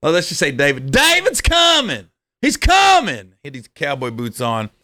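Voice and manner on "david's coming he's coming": high calling shout; yelled warning. Retold or imitated. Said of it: "three people yelled".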